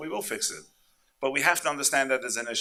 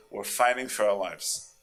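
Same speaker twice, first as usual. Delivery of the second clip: ordinary talk, nothing marked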